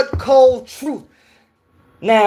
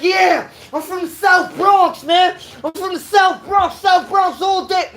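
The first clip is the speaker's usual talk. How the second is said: mock street brag; 7 semitones higher than usual. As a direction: shout it like a proud street kid. Mocking someone bragging about South Bronx.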